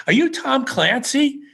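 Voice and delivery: high, drawn-out retold question; excited recognition. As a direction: imitate a starstruck stranger asking. Strangers excitedly mistake him for author.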